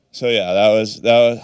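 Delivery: ordinary delivery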